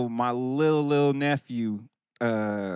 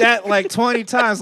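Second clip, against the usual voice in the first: ordinary talk, nothing marked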